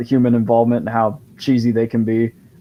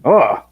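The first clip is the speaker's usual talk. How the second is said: higher, louder mock groan; disgusted groan; 4 semitones higher, 6 dB louder than usual. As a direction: imitate sneering critics with one groan. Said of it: "the critics, of course, are like".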